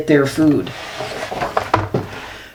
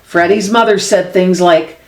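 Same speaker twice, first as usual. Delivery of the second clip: usual speaking voice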